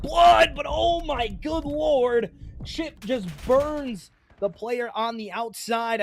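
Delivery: shouted high play call; excited. Real, not acted. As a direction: yell it as the play lands.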